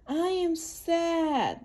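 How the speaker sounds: slow, high, drawn-out sad reading. Acted. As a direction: stretch each word like a sad little child. Reading the book's sad line.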